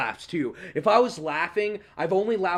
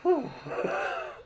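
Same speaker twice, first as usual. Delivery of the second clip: big relieved sigh; 10 semitones higher, 3 dB quieter than usual. Retold or imitated. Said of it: "I take my hand off my face and I go".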